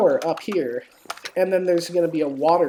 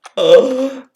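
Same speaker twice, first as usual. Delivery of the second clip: sharp, loud amazed gasp, pitched higher; 7 semitones higher, 14 dB louder than usual. Real, not acted. Seeing the wedding build, gasping in amazement. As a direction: gasp in real delight.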